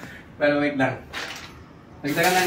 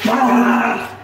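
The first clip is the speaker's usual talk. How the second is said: laughing scream; 5 semitones higher, 6 dB louder than usual. Real, not acted. Second dunk of ice water.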